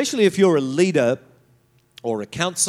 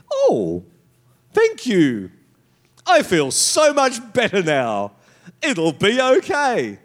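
sarcastic thanks; 4 semitones higher, 4 dB louder, slower than usual. Pretend. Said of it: "What are they supposed to say then?".

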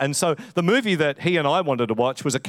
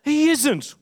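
put-on very high squeaky old-man voice; old man protesting; much slower than usual. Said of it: "And the old man says".

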